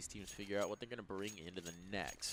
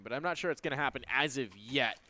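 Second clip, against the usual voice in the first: ordinary talk, nothing marked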